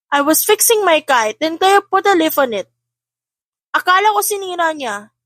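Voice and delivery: put-on child voice. A narrator's reading. Child explaining to father: light child voice. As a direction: plead your case to dad.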